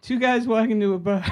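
high-pitched tearful joke setup; retold sobbing. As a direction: try to tell a joke while mock sobbing. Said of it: "I came out and I'm sobbing".

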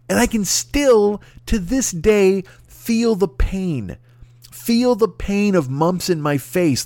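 slow, drawn-out and heavy; slow emphasis. Real, not acted. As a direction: drag the words out. Dwelling on remembered pain.

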